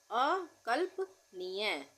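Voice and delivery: अकल्पनीय stretched, half ल audible; drawn-out अकल्पनीय for writing; slow dictation. Shown as a demonstration. Teacher dictating a hard word, very slowly. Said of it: "आधे ल का उच्चारण हो रहा है".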